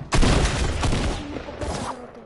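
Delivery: "Ahí tenés mi escopeta" roared out loud and raspy; loud taunt. Shown as a demonstration. Gamer taunting an enemy with his shotgun, loud roar. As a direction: throw the taunt at full volume. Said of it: "repeated taunt, louder".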